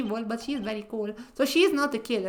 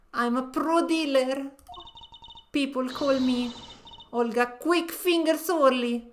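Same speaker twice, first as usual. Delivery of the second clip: put-on Russian accent; 4 dB louder than usual. Dealer bragging to the court, Russian accent. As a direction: brag about the nickname in a Russian accent. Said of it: "she doesn't have a Russian accent".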